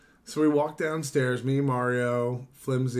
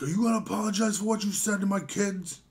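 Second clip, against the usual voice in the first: high-pitched impression of an irate man; put-on angry voice, pitched way up; mock angry demand; 8 semitones higher than usual. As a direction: imitate an indignant, high-voiced complainer. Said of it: "it's making him angry".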